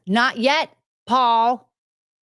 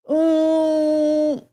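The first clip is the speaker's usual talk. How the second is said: cooing; 8 semitones higher than usual. Real, not acted. Streamer cooing at a raccoon video, tender.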